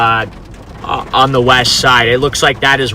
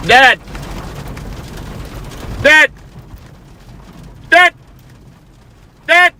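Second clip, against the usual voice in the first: shouting. Real, not acted. Calling to dad in car.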